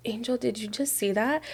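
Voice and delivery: imitating a spooked cousin, very softly; hushed question. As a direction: drop to a near-whisper for the quote. Cousin's hushed question in scary retelling.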